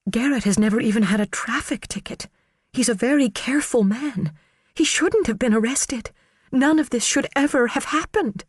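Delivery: high, pleading woman's voice; earnest, anxious defence of her husband; Karen's voice. Acted. Karen defending Garrett on the stand, high and pleading.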